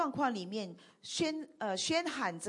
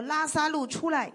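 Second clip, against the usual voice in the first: higher-pitched translated call; mild calling out; 5 semitones higher, 6 dB louder than usual. Acted. Interpreter echoing Jesus's call for congregation, raised calling voice. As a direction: call the name out without a full shout. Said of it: "就大声地呼叫说".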